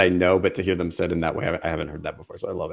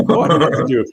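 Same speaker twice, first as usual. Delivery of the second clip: relieved surprise; 5 semitones higher, 4 dB louder, faster than usual. Retold or imitated. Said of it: "and I'm like".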